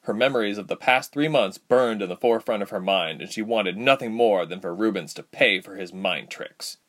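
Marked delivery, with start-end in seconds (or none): none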